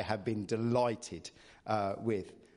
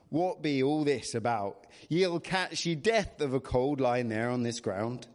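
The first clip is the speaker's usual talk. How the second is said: put-on rustic English shepherd accent; kindly scolding in country dialect; old countryman's rural accent; Mister Tandy; 4 dB louder than usual. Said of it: "You can't do it without an accent".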